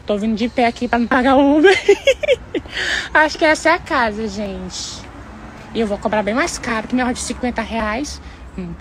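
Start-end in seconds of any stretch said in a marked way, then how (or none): 1.8-3.1 laughing crying. Acted. Laugh-cry over pain.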